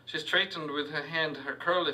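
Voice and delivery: usual speaking voice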